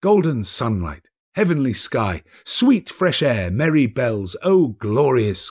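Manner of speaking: ordinary delivery, nothing marked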